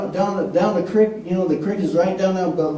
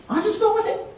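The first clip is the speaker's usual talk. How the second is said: pitched way up, rising at the end; high questioning repeat; 13 semitones higher than usual. Retold or imitated. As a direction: repeat it high, as if trying out the idea.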